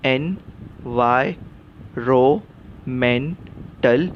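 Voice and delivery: slow, each syllable split apart. Shown as a demonstration. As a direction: break the word into its beats. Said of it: "उच्चार".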